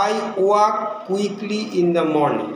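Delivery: each word spaced out, very slowly; slow dictation. Shown as a demonstration. Teacher dictating an example sentence to students, slow and deliberate. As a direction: read it slowly for the class to copy. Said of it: "আরেকটা দিচ্ছি".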